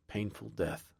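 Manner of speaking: plain reading voice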